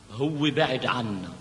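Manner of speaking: soft, wistful delivery; between laughing and crying. Acted. Woman speaking of lost love, wavering between laughing and crying. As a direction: say it softly, as if torn between laughter and tears. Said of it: "مثل اللي بدها تضحك وتبكي".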